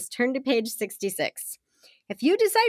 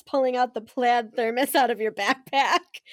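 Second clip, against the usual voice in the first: usual speaking voice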